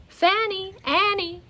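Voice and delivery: high, loud call into an empty shop; Mary calling for Fanny and Annie. Acted. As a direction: call across the empty room. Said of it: "Mary called out into the empty shop".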